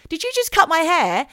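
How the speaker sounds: loud, high acted outburst; outraged confrontation. Perry swings round to confront the couple behind her, outraged. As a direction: voice the victim yelling in disbelief.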